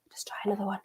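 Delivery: whispering to herself; whispered. Real, not acted. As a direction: breathe it, almost silent. Muttered to self, then repeated.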